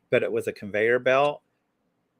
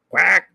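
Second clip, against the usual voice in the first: duck quack; 8 semitones higher, 9 dB louder than usual. Pretend. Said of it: "Be like".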